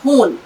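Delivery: खून said as ख़ून with a throaty ख़. Shown as a demonstration. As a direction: make the ख़ in खून scrape in the throat. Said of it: "फिर से दोहराइएगा मेरे साथ ही". (ख़ून में ख़ की सही गहरी आवाज़)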